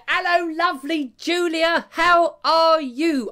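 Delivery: high, put-on gushing hello, stretched at the end; effusive greeting. Host greeting the lovely Julia, effusive hammed-up greeting. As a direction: gush it high, then draw out the question.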